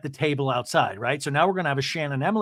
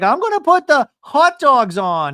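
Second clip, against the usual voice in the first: higher, louder chirpy announcement; imitating Gina; 11 semitones higher, 8 dB louder than usual. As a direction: play it bright and bossy. Gina voiced in recap.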